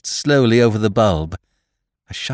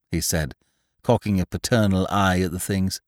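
usual speaking voice

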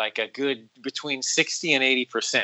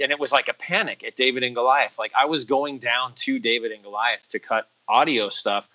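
ordinary delivery

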